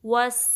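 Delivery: वस with a sharp whistling s; whistling seen. Shown as a demonstration. Teacher to students, showing the whistling seen. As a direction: hiss the s in वस. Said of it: "इस तरीके से".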